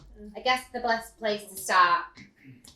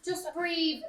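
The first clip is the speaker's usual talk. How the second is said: higher mimicked "Just breathe"; imitating a nurse yelling; mocking nurse; 8 semitones higher than usual. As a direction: parrot the nagging nurse. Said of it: "I yelled telling me".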